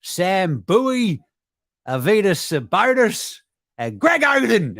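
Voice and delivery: lightly voiced, proud of a bad history; mock pride in big men; proud team exec. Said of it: "It's like".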